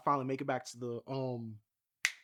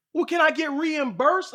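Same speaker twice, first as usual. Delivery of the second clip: incredulous ask; 11 semitones higher, 7 dB louder, slower than usual. Retold or imitated. Asking organisers for money back.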